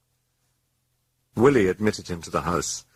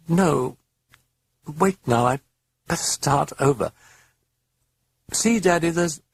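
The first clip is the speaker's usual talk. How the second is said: put-on high, light child speaking to her father; little girl voice; 10 semitones higher, 3 dB louder than usual. A girl correcting herself to her father, in a high child's voice. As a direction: talk up high like a small girl explaining to Daddy.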